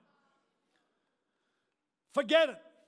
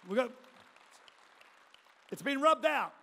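usual speaking voice

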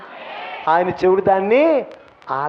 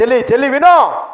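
higher and louder call across the room; 7 semitones higher, 6 dB louder than usual. Real, not acted. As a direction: get her attention from afar. Calling out to a sister.

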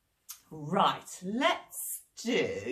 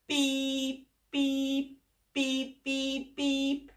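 higher, chirpy beeping; car horn beeps; 5 semitones higher, much slower than usual. Acted. Children's leader imitating the jeep horn. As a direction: beep like a toy horn.

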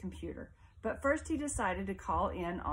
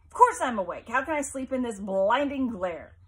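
shouted, higher and much louder; angry shout; 5 semitones higher, 10 dB louder than usual. Acted. Mother yelling at her son through the door, furious shout. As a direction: bark it through the closed door.

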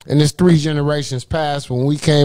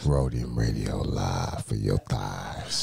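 radio announcer voice; 11 dB quieter, slower than usual. Pretend. Radio station ID voice.

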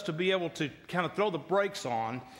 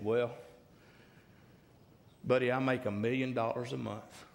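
unhurried, lower, self-assured drollery; carefree; 4 semitones lower, 5 dB quieter than usual. Retold or imitated. Relaxed old boy explaining himself to his friend, low and carefree. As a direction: let it drop out low and unbothered. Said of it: "not a care in the world".

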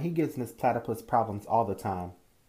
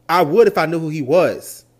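loud comeback; 3 semitones higher, 10 dB louder than usual. Acted. Mother's cutting comeback.

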